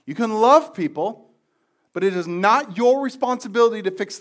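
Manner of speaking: loud, high, stressing "your" each time; full-force preaching. Real, not acted. Preacher to congregation on rescuing others, loud refrain. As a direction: pound each repetition harder.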